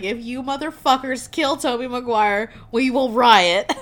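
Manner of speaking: loud, high crowd outrage; hammed-up threat building to a shout; mock threat. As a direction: belt it out as an angry audience.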